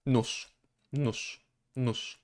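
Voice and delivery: "Nóż" with its end devoiced to sh. Shown as a demonstration. Teacher showing both spellings give the same sound, devoiced. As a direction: repeat "nóż" as noosh. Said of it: "the same sound".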